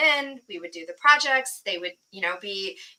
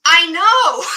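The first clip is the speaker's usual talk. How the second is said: laughing exasperation; 8 semitones higher, 12 dB louder than usual. Real, not acted.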